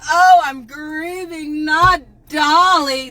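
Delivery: slow, hammed-up fake grief; drawn-out sarcastic mourning for a celebrity; mock grieving. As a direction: overdo the heartbreak to mock fans. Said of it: "They say [name]".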